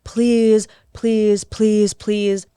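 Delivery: mild mock begging, word by word; imitated pleading. Imitating the boyfriend begging her to talk, mildly pleading. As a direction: repeat it as a lightly acted plea. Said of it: "He's begging and pleading".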